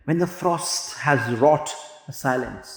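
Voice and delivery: slow, drawn-out recitation of verse; slow poetry reading. Shown as a demonstration. Teacher reading the poem to the class, slow and measured. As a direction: read the line slowly, savouring each word.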